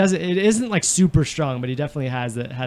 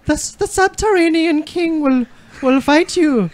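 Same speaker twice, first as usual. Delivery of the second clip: high-pitched mock-royal British; badly faked Queen's English; 10 semitones higher than usual. Amateur reading game text as Queen, high British accent. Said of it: "you don't just sound like the Queen".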